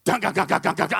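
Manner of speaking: imitated chugging guitar riff; heavy metal riff. Preacher mimicking a heavy metal band, chugging riff. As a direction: punch out staccato syllables like metal guitar. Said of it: "sounds like a heavy metal band".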